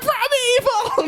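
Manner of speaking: squeaky shrieked plea; high shriek. Retold or imitated. Voicing Aunt May's shriek.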